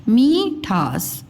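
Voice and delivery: मीठास slowly, mee held long; गलत लंबी ई. Shown as a demonstration. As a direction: draw out मी in मीठास. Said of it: "ये लिखा हुआ है मीठास". (मीठास में मी खींचकर)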